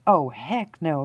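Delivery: loud, emphatic balking; retold refusal. Friend hearing thumps by the bridge, retold loud refusal. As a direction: imitate a friend flatly refusing to stay.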